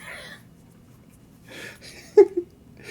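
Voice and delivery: a high, airy giggle at himself; high breathy giggle. Real, not acted. German streamer laughing at Scottish menu he can't read. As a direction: laugh off the confusion.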